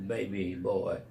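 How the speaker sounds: ordinary delivery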